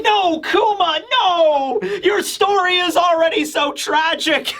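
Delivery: very high wailing imitation; mock anguished protest. Vegapunk protesting Kuma's sacrifice, mock anguish.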